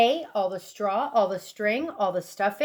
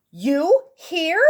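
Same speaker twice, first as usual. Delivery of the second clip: gasp; 5 semitones higher, 4 dB louder than usual. Acted. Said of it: "gasped Mister Bird".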